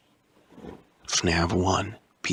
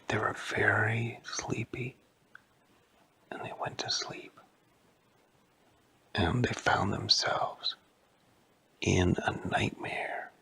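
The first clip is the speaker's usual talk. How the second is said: hushed sleepy narration; 6 dB quieter than usual. A narrator's reading. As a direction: lull them into sleep, softly. Narrator sends characters to sleep.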